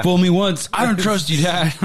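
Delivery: hammed-up kid's protest; imitated distrustful son. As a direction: play an indignant child scolding his father. Voices his distrustful son.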